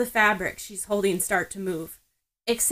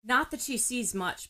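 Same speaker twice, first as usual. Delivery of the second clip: ordinary delivery, nothing marked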